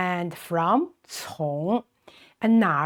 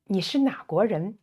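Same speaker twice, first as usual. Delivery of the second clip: 你是哪国人 run together fast; quicker repeat; 2 semitones higher, faster than usual. Shown as a demonstration. Teacher repeating which country question, at quick natural speed. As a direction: say the whole question in one fast flow.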